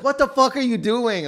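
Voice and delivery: high, loud disbelief; shocked yell. Retold or imitated. Crew shouting at the actor after the cut, high and loud. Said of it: "everyone's like".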